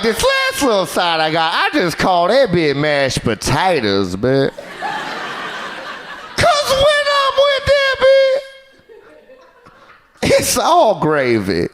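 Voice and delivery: high, slow sung rapper boast; quoted rapper. Retold or imitated. Comedian voicing a rapper's boast, high and drawn out. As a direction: croon it like a smug rapper. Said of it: "He's like".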